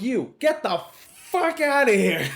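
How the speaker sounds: retold yell. Bouncer yelling an underage kid out, mock yell. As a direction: bark him out of the bar, half laughing. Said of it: "So I yell out his name".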